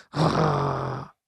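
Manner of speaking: low dog growl through the teeth. Acted. Dog Bob growls at the garden hose, low growl. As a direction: growl like a small dog at a toy.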